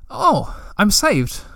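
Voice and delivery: drawn out, relief tinged with disappointment; a slow sigh of mild letdown; crestfallen. A narrator's reading. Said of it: "a little crestfallen".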